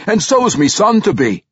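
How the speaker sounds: high, proud dwarf voice; hearty pride. A narrator's reading. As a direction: boast as a delighted father-to-be. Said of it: "Reginald said proudly".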